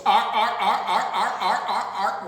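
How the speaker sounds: loud barking seal arfs; loud seal barks, with a laugh. Acted. Seal noises.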